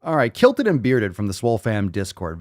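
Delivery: ordinary talk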